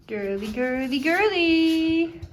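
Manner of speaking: sing-song call rising high and loud. Real, not acted. Calling out to "girly.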